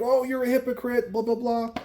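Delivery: high, nasal-free mock whine of critics. Vlogger mimics critics calling him a hypocrite, high mocking whine. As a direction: sneer it like an offended commenter. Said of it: "people are like".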